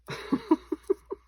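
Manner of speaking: quick high airy laugh; a high little giggle at her own joke. Real, not acted. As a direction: burst out in a short high laugh.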